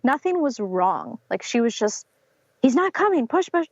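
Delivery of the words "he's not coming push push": higher, urgent mock coaching; insistent midwife. Midwife urging mother that baby isn't coming, mock urgent voice. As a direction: press her hard to push.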